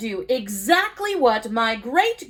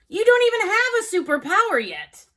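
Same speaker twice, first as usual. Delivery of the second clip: ordinary delivery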